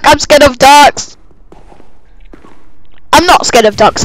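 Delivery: normal talking voice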